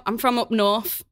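broad northern Mancunian accent, briefly shown off; Manchester accent. Shown as a demonstration. Guest shows host her lost home accent, Manchester accent. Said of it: "can you do a Manchester accent".

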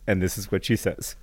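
usual speaking voice

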